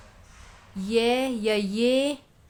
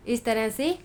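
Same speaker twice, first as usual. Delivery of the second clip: usual speaking voice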